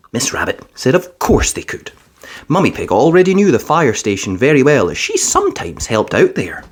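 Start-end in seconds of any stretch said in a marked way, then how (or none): none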